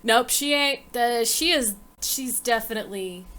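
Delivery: normal talking voice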